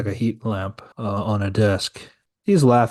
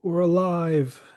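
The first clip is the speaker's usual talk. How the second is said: cartoon voice; 8 semitones higher, slower than usual. Boys wake up revived.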